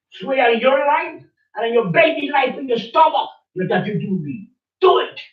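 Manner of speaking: high, insistent accusation; heated demand. Real, not acted. Heated demand to swear an oath.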